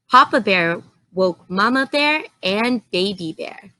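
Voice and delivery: plain reading voice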